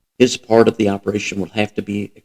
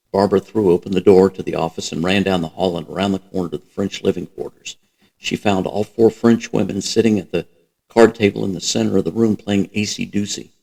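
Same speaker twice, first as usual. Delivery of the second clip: ordinary delivery, nothing marked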